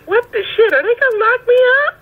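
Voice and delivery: high, rushed, disbelieving reenactment of alarm; retold alarm. Retold alarm at courtroom laughter.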